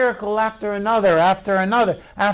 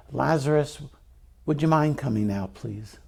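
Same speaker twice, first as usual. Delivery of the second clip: low, soft, mock-timid asking; hushed over-polite plea, trailing away; meek polite request; 6 semitones lower, 6 dB quieter than usual. Shown as a demonstration. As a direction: ask meekly, as if afraid to bother him. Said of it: "it wasn't like this".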